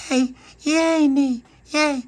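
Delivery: put-on very high loud toddler cheer; excited baby. Baby Lucia cheering for her jam butty, high baby voice. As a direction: cheer like a delighted tiny child.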